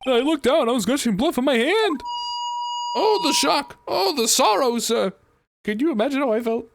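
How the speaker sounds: over-the-top grieving witness; dramatic witness. Acted. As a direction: play the shaken witness, hamming up the shock and sorrow. Voices a witness character's testimony.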